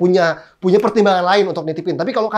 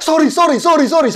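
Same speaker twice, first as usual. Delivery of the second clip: high, loud, flustered sorry to a stranger; panicked apology; 8 semitones higher, 6 dB louder than usual. Retold or imitated. Father apologizing to a startled Japanese man, panicked and loud. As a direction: blurt out apologies in a rush of panic. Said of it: "Saya panik banget dong".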